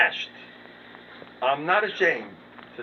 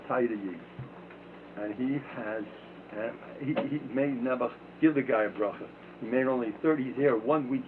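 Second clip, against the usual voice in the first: ordinary talk, nothing marked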